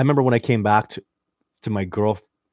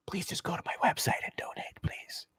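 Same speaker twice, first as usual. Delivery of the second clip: hushed, voiceless whisper; very soft, pleading whisper; whispered plea; 7 semitones higher, 13 dB quieter than usual. Real, not acted. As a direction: whisper it like a confidential aside. Pleads aside for website donations.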